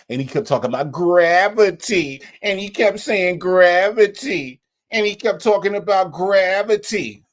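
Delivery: "gravity" stretched out long and loud; mock his way of talking, drawing out "gravity"; mocking odd speech. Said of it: "Why are you talking like that?".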